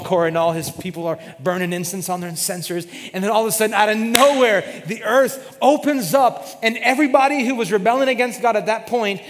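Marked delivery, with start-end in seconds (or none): 3.1-9.2 loud, building preacher's climax; full-force preaching. Acted. Pastor telling the church the earth opened, preaching loud. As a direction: preach it big as the ground opens.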